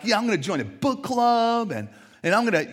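high and loud self-pep talk; pumped up. Pretend. To yourself in the mirror, pumped up, high and loud.

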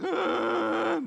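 high, loud, drawn-out adolescent groan; long high-pitched teenage "uuugh"; junior high boy groan. Retold or imitated. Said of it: "junior high boys would be more like".

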